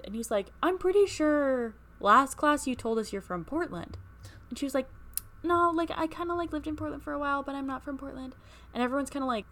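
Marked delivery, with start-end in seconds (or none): 0.6-4.0 lightly voiced teacher, higher and louder; imitating teacher. Teacher calling out the classmate, lightly voiced. 5.4-8.4 higher, defensive mock voice; imitating classmate. Classmate denying her hometown, mock high voice.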